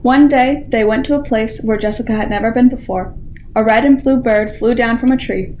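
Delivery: ordinary delivery, nothing marked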